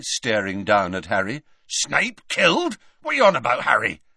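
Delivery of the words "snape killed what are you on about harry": loud disbelief. A narrator's reading. Hagrid staring down at Harry, loudly disbelieving. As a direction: raise the pitch and demand sense. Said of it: "said Hagrid loudly".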